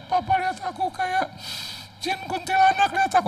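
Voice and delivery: put-on very high, squeaky child's voice; little girl voice. Little daughter teasing her papa, very high child voice. Daughter, mocking ghost eyes.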